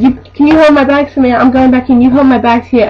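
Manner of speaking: imitating a pushy customer giving orders; bossy customer impression. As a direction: order the cashier to mind your bags. Customer ordering cashier around.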